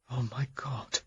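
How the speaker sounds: breathless stunned whisper; shocked whisper. Acted. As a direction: barely voice the words in shock. Shocked news of possible amputation.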